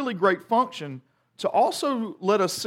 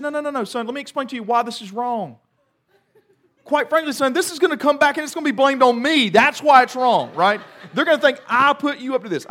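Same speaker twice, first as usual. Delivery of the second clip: higher, louder, faster exasperated scolding; mock fatherly lecture; 8 semitones higher, 5 dB louder than usual. Father explaining to his son why it's wrong, exasperated. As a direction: lay down the law, half laughing.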